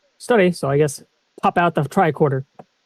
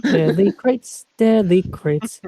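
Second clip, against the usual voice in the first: slow savouring; much slower than usual. Pretend. Savours the phrase slowly.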